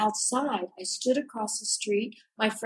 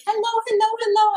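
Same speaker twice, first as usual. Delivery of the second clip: much higher, louder sing-song hellos; eager shopkeepers' greeting; 13 semitones higher, 5 dB louder, faster than usual. Retold or imitated. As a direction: imitate the fishmongers calling her in. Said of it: "they'd be like".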